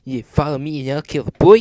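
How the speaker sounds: mock the threat back; taunting put-on voice. A narrator's reading. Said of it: "mocked Sonic".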